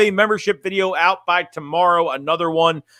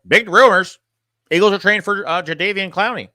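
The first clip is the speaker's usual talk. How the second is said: mock rumor announcement, pitched up; Thomas impression; 3 semitones higher than usual. Streamer making fun of somebody's rumor videos, mocking announcer voice. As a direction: announce it like a hyped-up parody host. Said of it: "ending with Thomas Mon".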